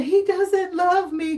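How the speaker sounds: high, slow overdramatic whine; hammed-up lovesick whimper; mock heartbroken whine. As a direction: pout it like a soap opera lover. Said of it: "I can't say".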